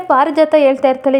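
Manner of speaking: plain reading voice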